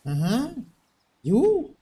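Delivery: ordinary talk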